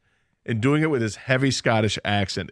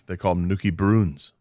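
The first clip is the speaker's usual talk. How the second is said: usual speaking voice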